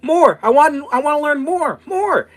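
put-on very high, loud squeaky child voice; young girl voice. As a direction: squeal it like an eager little girl. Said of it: "She goes".

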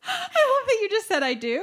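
high squealing laugh through words. Real, not acted. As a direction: crack up while talking, pitched high. Laughing at friend's reply.

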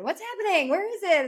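high-pitched retold yell at a husband; hammed-up frantic demand, pitch soaring; mock yell. Wife yelling at her husband over a late cycle, high mock yell. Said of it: "I yell at my husband".

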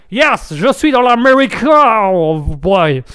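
French in a slow, hammed-up American accent; accent américain. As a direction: caricature d'Américain lente et aiguë au départ. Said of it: "n'est-ce pas, l'Américain?". (accent américain caricatural)

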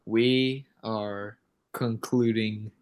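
slow, deliberate announcer close; mock-solemn sign-off. Host closes today's podcast episode, slow and ceremonious. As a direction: space out the words as a formal outro.

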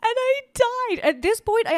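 high laughing squeal. Real, not acted. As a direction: laugh through the words. Laughing after hater reply.

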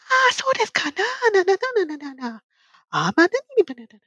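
mock high-pitched Japanese speech; high Japanese female voice. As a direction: imitate a super high polite Japanese woman. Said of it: "speak in a much higher voice if you're female".

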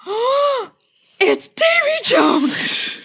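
very high, drawn-out fangirl squeal; hammed-up slow, high swoon; starstruck swooning. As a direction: gush like a fan spotting an idol. Fans seeing their idol, retold.

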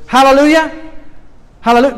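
loud, high hallelujah; joyful exclamation. Real, not acted. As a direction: burst out in praise. Preacher exclaims praise.

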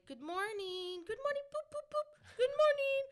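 very high, sing-song cooing voice; baby talk. Retold or imitated. Greeting the dog as it wakes up, baby talk. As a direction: coo sweetly at a pet in a high little voice. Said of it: "I treat Mando like a baby".

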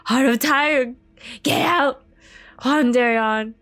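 high, loud yell; angry crowd shout. Acted. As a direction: drive someone away with a shout. Crowd drives the boy out.

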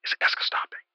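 whispered name. Acted. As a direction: whisper it like a secret. Whispered term.

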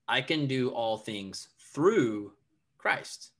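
"through" drawn out and lifted, pause before "Christ"; stress on THROUGH. Shown as a demonstration. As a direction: slowly lean on "through", then pause. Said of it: "I would emphasize different words".

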